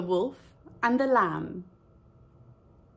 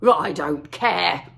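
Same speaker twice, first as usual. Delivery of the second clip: low, slow, loud snarl; snarling wolf; 4 semitones lower, 6 dB louder, slower than usual. Acted. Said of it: "snarled the wolf".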